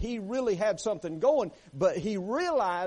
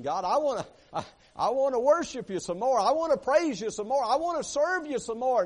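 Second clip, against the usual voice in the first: higher, louder, rising plea to God; fervent pleading prayer; 8 semitones higher, 5 dB louder than usual. Acted. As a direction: beg God to let him keep worshipping. Preacher acts psalmist pleading with God.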